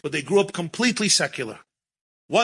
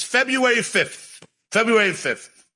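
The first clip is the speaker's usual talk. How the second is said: quoted woman; 7 semitones higher than usual. Retold or imitated. Woman repeating date, higher.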